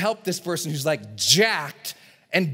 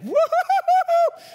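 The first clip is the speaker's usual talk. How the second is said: very high, loud laughing whoop; ecstatic whoop; 7 dB louder, slower than usual. Retold or imitated. Energized person among good people, whooping. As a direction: whoop like pure joy bursting out. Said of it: "you're like".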